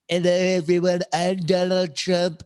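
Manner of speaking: mangled, over-the-top presidential impression; Donald Trump. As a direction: do a terrible old take on Trump, drawn out at the end. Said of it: "videos of me doing, uh, Donald Trump".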